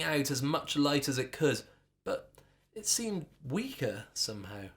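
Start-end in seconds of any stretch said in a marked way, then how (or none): none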